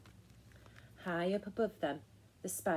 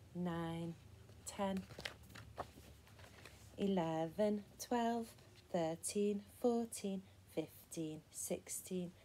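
softer, measured counting with gaps; count-along; 5 dB quieter, slower than usual. Shown as a demonstration. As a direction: count evenly so children can join in. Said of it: "count along with me".

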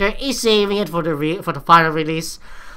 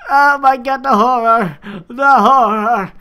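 loud, higher-pitched hammed-up dread; mock horror; 4 semitones higher, 5 dB louder than usual. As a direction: cry out in exaggerated horror, drawing out the last words. Mock dismay at cute ponies.

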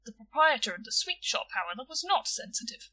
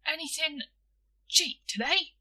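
raised, hopeful ask; 6 semitones higher, 4 dB louder than usual. Acted. Boy asking shopkeeper for something cheap, hopeful. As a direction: angle for a bargain, higher pitch. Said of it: "went on William hopefully".

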